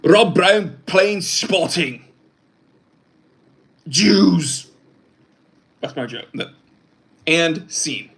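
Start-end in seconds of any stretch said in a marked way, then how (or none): 0.0-2.0 higher, louder put-on movie-star voice; slow, do a famous actor's voice; mock celebrity voice, slow and raised; Mel Gibson impression. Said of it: "that was my Mel Gibson impression". 3.9-4.7 higher, louder put-on movie-star voice; slow, do a famous actor's voice; mock celebrity voice, slow and raised; Mel Gibson impression. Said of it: "that was my Mel Gibson impression".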